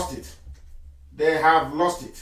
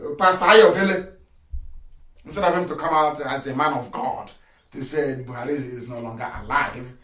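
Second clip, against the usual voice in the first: ordinary delivery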